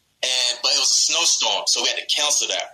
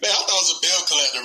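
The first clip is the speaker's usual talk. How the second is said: normal talking voice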